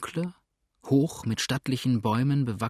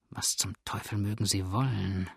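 hushed aside, softer; whispering to himself; 3 semitones lower, 6 dB quieter than usual. A narrator's reading. Steersman wondering to himself, low murmur. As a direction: murmur it privately under your breath. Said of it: "flüsterte er vor sich hin".

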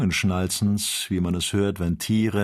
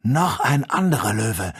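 louder cry of alarm; calling out; 3 semitones higher, 6 dB louder than usual. A narrator's reading. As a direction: cry out at a new danger. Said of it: "rief Joe". (erschrockener, lauter Ausruf)

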